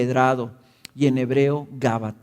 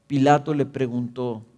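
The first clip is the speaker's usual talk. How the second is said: ordinary delivery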